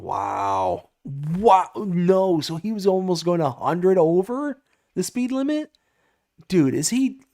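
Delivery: can't believe what chat just said; shocked. Real, not acted. Streamer reacting to a friend going a hundred over, stunned. Real shock at chat's news.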